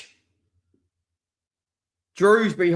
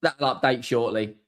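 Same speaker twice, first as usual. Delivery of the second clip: ordinary talk, nothing marked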